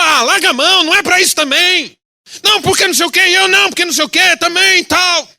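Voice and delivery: high, loud hammed-up quarrel; mock argument. Pastor imagining talking back to the driver, mock angry quarrel. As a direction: bicker back in a pretend fight.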